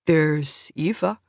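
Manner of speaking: slow and musing; thoughtful. A narrator's reading. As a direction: weigh the name, half to himself. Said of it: "Nilsson said thoughtfully".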